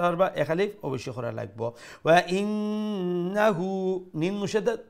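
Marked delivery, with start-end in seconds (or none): 2.0-3.7 recited ওয়া ইন্নাহু with a long hummed noon; slow chant, hold the doubled n in ইন্নাহু; held nasal ghunna. Shown as a demonstration. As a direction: draw out the n through the nose. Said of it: "ওয়াজিব গুন্না, আকমাল গুন্না".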